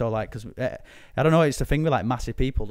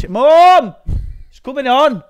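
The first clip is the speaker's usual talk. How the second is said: shouting much higher and louder; calling out; 13 semitones higher, 13 dB louder, slower than usual. Pretend. Calling his mum that the show is on, loud high shout. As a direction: yell across the house to mum.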